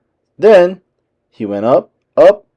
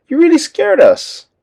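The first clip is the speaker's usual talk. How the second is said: relieved exclaim; 3 dB louder than usual. A narrator's reading. Minnie relieved after scare.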